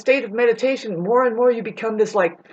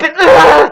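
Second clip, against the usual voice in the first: disgusted groan; 7 semitones higher, 10 dB louder than usual. Real, not acted. Disgusted groan after the quote.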